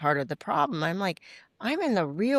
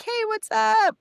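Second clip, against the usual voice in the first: much higher, loud bright hello; cheery reply; 5 dB louder, slower than usual. Retold or imitated. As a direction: answer with a big happy greeting. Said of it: "I'm like".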